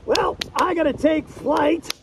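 usual speaking voice

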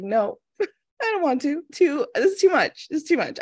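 high-pitched mock-panicked refusal; overwhelmed protest. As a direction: balk like a reluctant child, half laughing. Acting out her refusal to lead.

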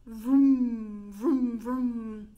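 loud lip-buzzing vroom; silly clown. Shown as a demonstration. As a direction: buzz your lips like a revving car. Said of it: "make that noise where your lips vibrate".

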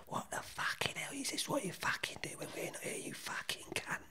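high, hushed imitation of someone going mad; hissed, whispered mock rage; mock furious whisper. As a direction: rant under your breath, imitating a furious outburst. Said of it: "Claire Ince going mad".

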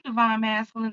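usual speaking voice

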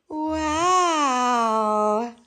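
ordinary talk, nothing marked

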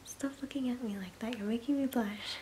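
ordinary delivery

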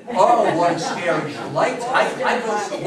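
ordinary delivery

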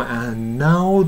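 "and now" as a drawn-out an-now; stretched "and now" with no audible d; d dropped. Shown as a demonstration. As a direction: let the n swallow the d in "and now". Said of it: "って言っても良さそうなもんだけども".

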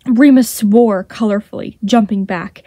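ordinary delivery, nothing marked

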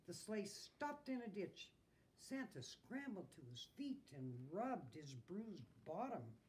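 usual speaking voice

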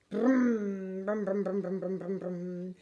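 brum brum, fading to almost nothing; the bus drives away into the distance; bus engine noise. Retold or imitated.

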